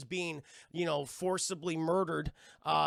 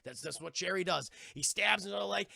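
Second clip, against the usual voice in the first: ordinary talk, nothing marked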